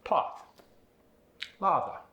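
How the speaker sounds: British broad AH in path and lather; British accent, path with AH; British AH sound. Shown as a demonstration. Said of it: "are gonna become".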